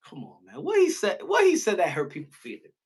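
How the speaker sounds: ordinary delivery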